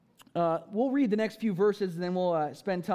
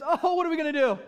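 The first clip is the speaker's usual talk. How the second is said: high, loud mock wail of helplessness; hammed-up fretting, pitched up and louder; mock panic; 8 semitones higher, 5 dB louder, faster than usual. Disciples on reaching the desolate place, in mock panic.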